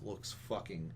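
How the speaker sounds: ordinary delivery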